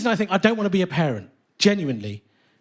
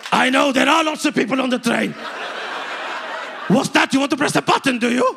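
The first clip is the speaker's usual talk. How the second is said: loud, higher, showy voice for the carriage; performance uncle; 7 semitones higher, 3 dB louder than usual. Retold or imitated. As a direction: broadcast it to the whole train. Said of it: "complete dick in public".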